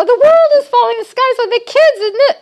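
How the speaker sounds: over-the-top panicked worry; mock panic. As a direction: play the frantic wife, the sky falling. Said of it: "And I go".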